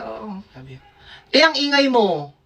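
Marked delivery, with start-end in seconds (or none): 1.3-2.3 mock scolding roommate. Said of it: "Sabi niya sa akin ng ganun".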